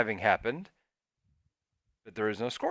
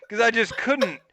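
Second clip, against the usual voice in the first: ordinary talk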